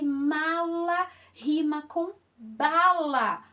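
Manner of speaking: loud, slow teaching emphasis on "bala"; sound out rhymes. Shown as a demonstration. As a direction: draw out "mala" and "bala" to show the rhyme. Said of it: "vamos perceber os sons".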